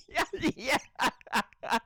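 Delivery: ordinary delivery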